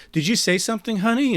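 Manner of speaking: sing-song like a wife who heard everything; sweet suspicious robot spouse. Acted.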